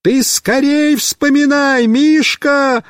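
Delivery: high, slow urging yell; shouting. Acted. Boy urges friend to remember, urging shout. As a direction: hurry him with a shout. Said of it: "опять кричу я".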